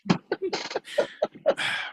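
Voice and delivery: long breathy high sigh; theatrical sigh. Acted. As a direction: heave an overdone sigh. Dramatic sigh.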